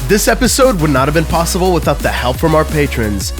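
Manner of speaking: upbeat podcast outro; outro read. Acted. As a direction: read the credits brightly. Patron credits outro read.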